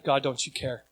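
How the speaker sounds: quiet prayer. Retold or imitated. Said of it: "We've all said".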